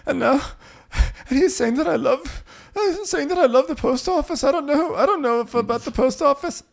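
very high, loud parody whine; hammed-up weepy falsetto; mock tearful confusion. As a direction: wail it in a squeaky put-on voice. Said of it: "so she cries".

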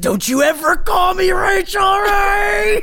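a friend's jab met with hammed-up shouting; mock outrage. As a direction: yell it in playful offense.

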